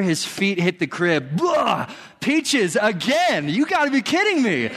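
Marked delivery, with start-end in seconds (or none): 1.4-1.9 retching blah. Retold or imitated. Retching sound while retelling vomit. 2.2-4.7 exasperated disbelief. Retold or imitated. Retold shock at second vomit.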